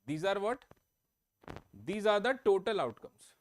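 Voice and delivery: ordinary delivery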